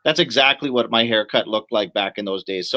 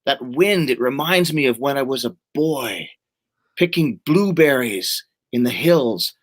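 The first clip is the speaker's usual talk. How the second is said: lightly acted film monologue; slow, brooding recitation with pauses; a hint of a movie warrior reminiscing; Conan; slower than usual. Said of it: "Conan's sitting there, and he says".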